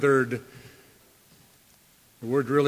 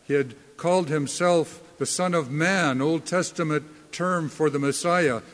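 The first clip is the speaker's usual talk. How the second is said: normal talking voice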